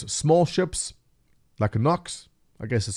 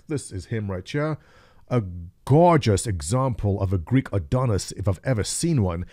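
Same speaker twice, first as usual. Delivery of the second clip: usual speaking voice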